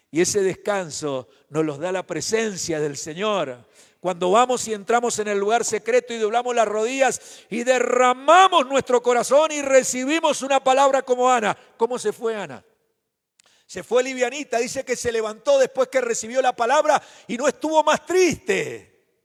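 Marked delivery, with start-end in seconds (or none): 4.0-12.6 building to a loud, high-pitched sermon climax; impassioned pulpit crescendo; full-force preaching. Real, not acted. 13.7-19.2 loud, high, fervent pulpit proclamation; impassioned sermon delivery, raised voice; full-force preaching. Real, not acted.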